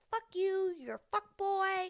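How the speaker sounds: high-pitched mock anger; drawn-out, high imitation of an angry woman; imitating mad outburst. Angry woman cursing out the man, high mock outrage. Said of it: "She gets so mad".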